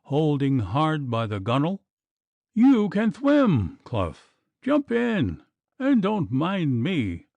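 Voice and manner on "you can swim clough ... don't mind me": a high, slow, noble self-sacrifice; sublimely. A narrator's reading. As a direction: nobly urge him to save himself. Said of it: "said little Puttock sublimely".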